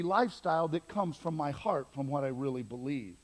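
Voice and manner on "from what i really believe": ordinary talk, nothing marked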